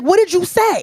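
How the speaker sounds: high-pitched incredulous comeback; retold disbelief. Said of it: "I was like".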